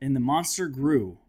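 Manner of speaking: ordinary talk, nothing marked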